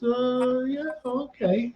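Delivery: high, soft, unsure little voice; hesitant kid. Retold or imitated. Little boy answering invitation to play baseball, hesitant. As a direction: imitate a shy boy tentatively agreeing.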